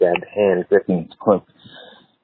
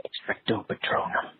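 whispered "Expecto Patronum"; under his breath; 11 dB quieter than usual. A narrator's reading. Harry practises the charm quietly to himself, whispered. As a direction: breathe the spell without voice. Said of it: "under his breath".

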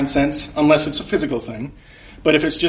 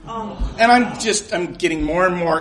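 ordinary delivery

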